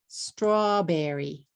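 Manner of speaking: "Strawberry" slowly, in three beats straw-be-rry; three-syllable English. Shown as a demonstration. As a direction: stretch "Strawberry" with str kept as one cluster. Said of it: "Three syllables".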